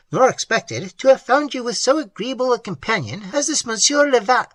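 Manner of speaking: mock the niece's excuse with scorn; sarcastic haughty aunt. A narrator's reading.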